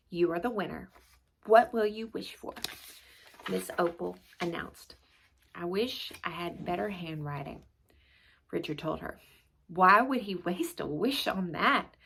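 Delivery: steady read-aloud storytelling voice; story narration. A narrator's reading. Narrator reading a chapter book to viewers, storytelling. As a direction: tell the class story to young listeners.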